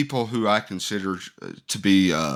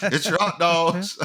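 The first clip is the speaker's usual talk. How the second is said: stadium vendor hawking; 7 semitones higher than usual. Shown as a demonstration. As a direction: call it up the stands like a hawker. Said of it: "I guess I'd just go around yelling".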